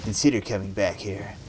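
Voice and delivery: understated cold reproach; cold, distant. A narrator's reading. Mother to unwelcome daughter, coolly reproachful. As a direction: hold the daughter at arm's length, coolly. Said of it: "Her voice was distant, cold".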